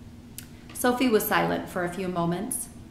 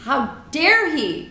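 slow, loud, higher outrage; Sophie crying out; 7 semitones higher, 6 dB louder, slower than usual. A narrator's reading. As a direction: stretch out an indignant cry. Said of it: "cried Sophie".

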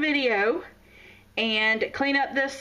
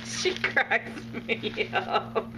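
laughing; much slower than usual. Real, not acted. Amused by her friend Jade, laughing through the words. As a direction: laugh as you say it.

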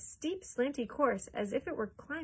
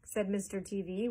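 ordinary delivery

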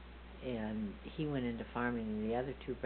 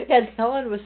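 talking through laughter, pitched high and loud; can barely get it out for laughing; laughing through words; 15 dB louder than usual. Real, not acted. Laughing while telling parrot story.

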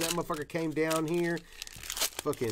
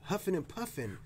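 ordinary talk, nothing marked

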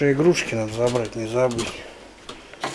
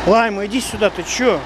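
raised call up high; calling the dog; 7 semitones higher than usual. Real, not acted. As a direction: call her over across the yard.

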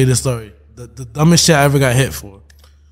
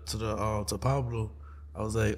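usual speaking voice

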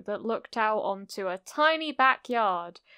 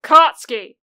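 very loud, higher-pitched reprimand; angry scolding; 6 semitones higher, 16 dB louder than usual. A narrator's reading. Auntie scolds her son, loud and angry. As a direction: call the child out sharply. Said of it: "Auntie scolded, sounding angry".

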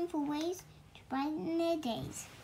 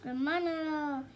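ordinary delivery, nothing marked

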